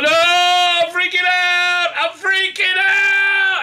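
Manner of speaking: hammed-up panicked shriek; very high, loud, drawn-out wail; Saru. Imitating Saru starting to freak out, panicked wail. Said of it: "he starts to freak out".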